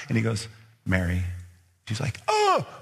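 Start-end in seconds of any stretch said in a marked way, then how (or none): 0.8-1.2 low, gentle calling of her name; gentle naming. Acted. Jesus gently calls Mary by her name. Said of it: "the way he said my name". 2.3-2.7 high gasp of recognition; a startled, joyful oh; sudden recognition. Acted. Mary realizing it is Jesus, surprised.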